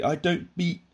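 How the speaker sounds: high-pitched, cut-off catchphrase; Victor Meldrew catchphrase. Retold or imitated. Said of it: "It'd just be".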